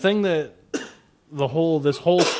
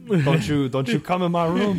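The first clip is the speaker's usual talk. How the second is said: higher, hammed-up indignant warning; mock scolding; 4 semitones higher than usual. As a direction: shoo the wife away in mock outrage. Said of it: "I'm gonna tell my wife".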